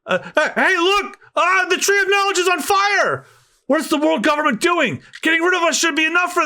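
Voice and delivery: high, alarmed crowd voices; voiced scholars crying out in panic; panicked scholars. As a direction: shout the alarm high and urgent.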